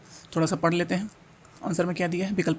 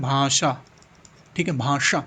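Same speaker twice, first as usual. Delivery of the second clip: ordinary talk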